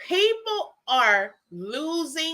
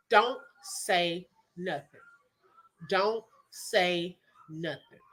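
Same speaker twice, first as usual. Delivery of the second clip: lower, slow, soft inner warning; a calm voice holding her back, low and slow; the Lord's warning; 8 semitones lower, 8 dB quieter, slower than usual. Retold or imitated. The Lord telling her to stay quiet, low and slow.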